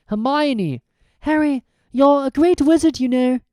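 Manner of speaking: high, choked-up praise; emotional Hermione. A narrator's reading. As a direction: say it hugging him. Hermione tearful hug.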